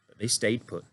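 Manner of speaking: plain reading voice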